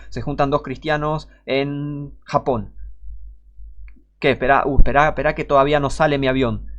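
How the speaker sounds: ordinary talk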